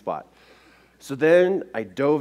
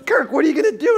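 alarmed wife; 13 semitones higher, 5 dB louder, faster than usual. Retold or imitated.

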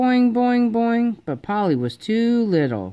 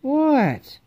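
put-on child voice; 8 semitones higher than usual. Child answering call.

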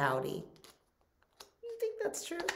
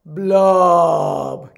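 fish going blub, growing louder; gurgling fish man croak; 11 dB louder, much slower than usual. Acted. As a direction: bubble it out, louder each time. Fish bubbling sound.

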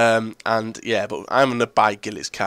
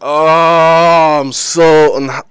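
loud drawn-out wail; anguished frustration; 3 semitones higher, 7 dB louder, much slower than usual. Real, not acted. Gamer reacting to losing the match, anguished frustration. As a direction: wail it out, cut short mid-word.

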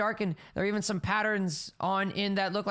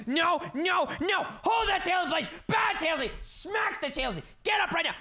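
scolding shout; 9 semitones higher than usual. Acted. Scolding own tail.

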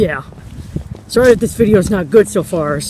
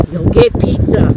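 normal talking voice

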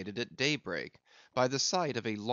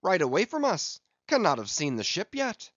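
high, raised report; crying out; 6 semitones higher, 5 dB louder than usual. A narrator's reading. Stubb reporting the whale's heading to Ahab, crying out. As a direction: call the whale's course out to the captain. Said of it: "cried Stubb".

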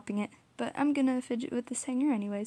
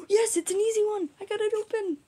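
high, loud burst of relief; excited relief; 11 semitones higher, 4 dB louder than usual. Real, not acted. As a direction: cheer as the cap gives. Bottle opens easily, gasp.

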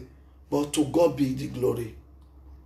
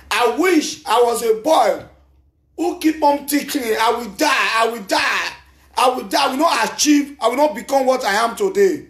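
loud, high-pitched preaching climax, hammering each I will die; shouted preaching; 8 semitones higher, 6 dB louder than usual. Acted. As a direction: cry it out to the congregation, building to the peak.

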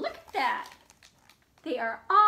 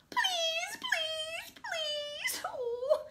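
high, whiny begging cry; pleading cry; 14 semitones higher, 5 dB quieter, much slower than usual. Acted. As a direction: beg like kids whining to go. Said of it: "cried Junie and Jakey".

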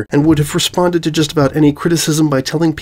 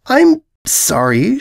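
slow, much higher, disbelieving; incredulous apology; 9 semitones higher, much slower than usual. Acted. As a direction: stretch out the disbelief. Purple mare incredulous.

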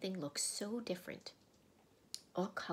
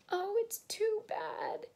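high, loud, drawn-out wail; acted sobbing; 6 dB louder than usual. A narrator's reading. Lucy finds the body gone, high sobbing lament. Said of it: "sobbed Lucy".

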